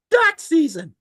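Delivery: high, loud insistent shout. Acted. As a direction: yell it back in the argument. Cartoon argument impression.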